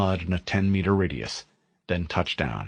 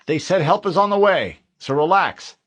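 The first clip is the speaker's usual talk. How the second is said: higher, louder forced reassurance; corporal to his men; 10 semitones higher, 7 dB louder than usual. Acted. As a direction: tell the men to relax, loudly. Harlan tells his men.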